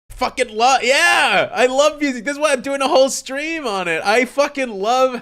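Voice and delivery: thrilled fan raving; excited gushing. Real, not acted. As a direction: let the love for music spill out. Gushing about loving music.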